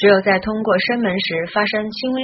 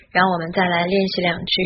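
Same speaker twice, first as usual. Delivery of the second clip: normal talking voice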